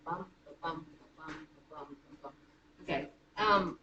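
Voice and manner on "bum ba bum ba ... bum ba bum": soft, low "Bum, ba-bum" beats with the stress on each second "bum"; iambic da-DUM rhythm. Shown as a demonstration. Teacher to class, showing iambic pentameter with a quiet chant. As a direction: tap out the iambic beat under the breath. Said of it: "ten syllables".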